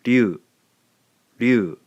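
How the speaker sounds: ryu said as one glide; sound ryu. Shown as a demonstration. Teacher modelling the tricky ryu sound. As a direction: blend ri and you into ryu. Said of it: "ri and you gives us".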